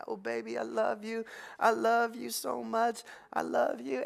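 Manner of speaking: soft, high cooing to a newborn; tender father's baby voice; baby talk. Retold or imitated. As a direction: coo it like holding your baby girl.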